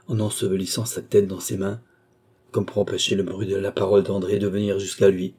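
usual speaking voice

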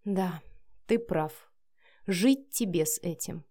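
subdued, resigned bitterness; bitter. A narrator's reading. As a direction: concede with a touch of bitterness. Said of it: "Вика горько усмехнулась". (с лёгкой горечью)

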